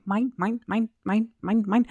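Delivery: squawking mine, mine; lightly voiced seagull squawk; Finding Nemo seagulls. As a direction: imitate greedy seagulls. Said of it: "the seagulls from Finding Nemo".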